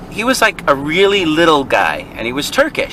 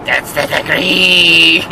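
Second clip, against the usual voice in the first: slow, drawn-out put-on voice; mock insane little man; much slower than usual. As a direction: stretch it out like a crazed little manager. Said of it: "like some insane little person".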